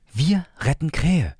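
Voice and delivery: hohe, langsame Kinderstimme, Betonung auf wir; selbstverständlicher kleiner Bruder. Acted. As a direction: ganz bestimmt wie ein Kind. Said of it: "als wäre das das Selbstverständlichste". (hohe, langsame Kinderstimme)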